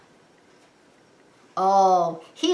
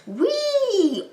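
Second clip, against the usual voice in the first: excited whee; 13 semitones higher than usual. Acted. Aliens zooming off.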